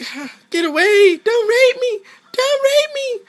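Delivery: very high, loud panicked squeal; mock damsel shrieking for help; voicing the opponent's fighter. Gamer voicing the knocked-down fighter, mock panicked shriek. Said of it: "So she's like".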